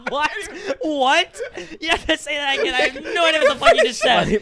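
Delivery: high, loud shriek through laughter; helpless, squealing laughter; laughing disbelief. Real, not acted. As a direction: demand a repeat while cracking up. Cracking up at garbled card answer.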